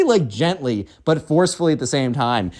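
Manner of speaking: ordinary delivery, nothing marked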